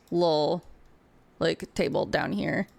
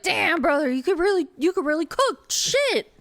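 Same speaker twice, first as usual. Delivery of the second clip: higher, louder hearty chef voice; the cook praising the orc's food, much higher and louder; chef voice retry; 10 semitones higher, 3 dB louder than usual. Acted. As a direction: land the chef's praise, big and high. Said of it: "He's like".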